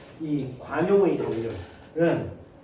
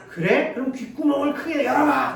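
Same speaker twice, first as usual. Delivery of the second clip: high, loud mocking snap; rude retort; 9 semitones higher, 5 dB louder than usual. Teacher mocking a student who can't hear, rude loud retort. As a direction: brush the student off rudely, louder.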